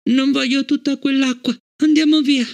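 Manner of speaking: put-on a scared little girl begging to leave; whimpering child. A narrator's reading. Frightened Fallon begs to get away from the water, high whimper. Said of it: "Fallon gemette".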